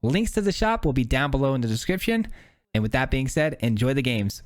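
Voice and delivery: upbeat promo read. Acted. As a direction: pitch the merch like an ad. Channel shop plug before video.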